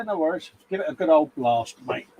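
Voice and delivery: normal talking voice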